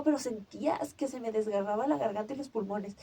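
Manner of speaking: ordinary delivery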